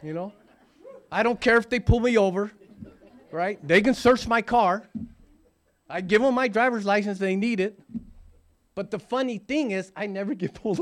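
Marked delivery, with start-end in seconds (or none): 1.1-7.6 loud and high, bursting with joy; excited. Real, not acted. Recovered alcoholic to a meeting, excited and loud. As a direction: shout out how little the cops scare him. Joyful freedom testimony.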